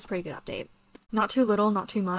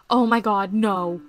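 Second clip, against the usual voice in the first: sudden loud "oh my god, no"; alarmed outburst; 8 dB louder, slower than usual. Real, not acted. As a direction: blurt it out in alarm. Startled by game glitch.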